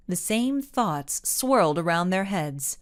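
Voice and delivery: plain reading voice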